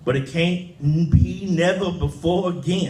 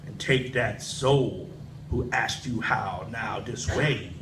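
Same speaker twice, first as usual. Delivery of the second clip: devil's threat; 7 semitones lower, 8 dB quieter than usual. Acted.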